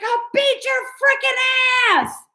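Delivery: retold shouted threat. Him threatening the yelling woman, mock shout. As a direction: threaten her, hammed up. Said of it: "It's like".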